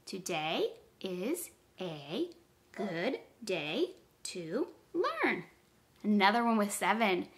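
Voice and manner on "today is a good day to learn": slow, soft, separated words. Shown as a demonstration. Teacher counts words with pupils, slow and separated. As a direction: say each word alone, unhurried. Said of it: "count how many words are in that sentence".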